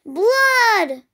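storybook cry, falling at the end; father's yell. A narrator's reading. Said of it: "Loretta's father yelled".

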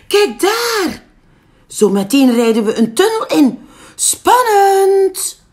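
high and loud, full of thrill; excited. Acted. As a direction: build it up like a big adventure. Egel announcing the tunnel.

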